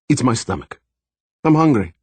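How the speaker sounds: hint of a German accent, joking; a jolly German doctor teasing; light German accent. A narrator's reading. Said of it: "he said in his German accent".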